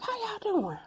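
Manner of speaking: high cheerful mom greeting; imitating mom. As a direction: chirp it like mom. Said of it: "And she was like".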